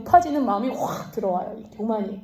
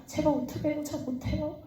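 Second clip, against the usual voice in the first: higher, much softer timid plea; timid refusal; 4 semitones higher, 9 dB quieter than usual. Retold or imitated. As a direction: shrink away from the task. Timid refusal to God voiced. (움츠러든 작은 목소리)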